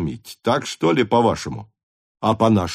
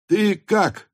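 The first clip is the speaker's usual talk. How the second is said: very high exclaimed question; surprised greeting; 12 semitones higher, 3 dB louder than usual. Acted. Officers greet newcomer in surprise. (удивлённый высокий возглас)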